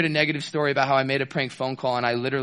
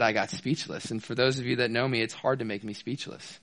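ordinary delivery

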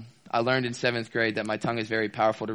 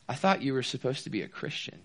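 softer, quietly let-down remark; soft disappointment; 6 dB quieter than usual. Retold or imitated. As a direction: say it quietly, almost hurt. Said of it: "She said".

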